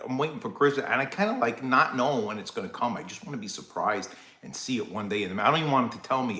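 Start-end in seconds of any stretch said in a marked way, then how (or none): none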